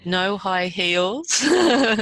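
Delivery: real laughter listing what she prayed to avoid; laughing. Real, not acted. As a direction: let the laughter through each item. Laughing over her prayer list.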